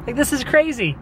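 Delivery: high incredulous what the heck; playfully baffled, voice jumping up; retold shock at the empty shop; mock disbelief. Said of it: "So I'm like".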